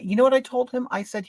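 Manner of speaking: ordinary talk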